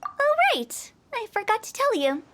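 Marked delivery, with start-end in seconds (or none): 0.0-0.9 high, sudden oh right; sudden realization. Acted. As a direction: catch yourself remembering, pitched up. Suddenly remembering.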